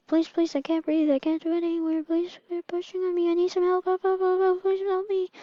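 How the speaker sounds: put-on high, breathless-sounding baby plea; acted high-pitched panic, words tumbling out; panicked pleading. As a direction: beg frantically in a high pretend voice. Suffocating baby pleads for help.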